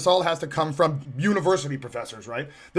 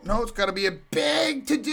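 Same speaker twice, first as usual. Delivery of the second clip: usual speaking voice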